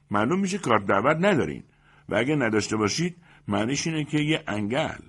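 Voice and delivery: high, loud officious demand; demanding best man. A narrator's reading. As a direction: hands on hips, demand to see the card.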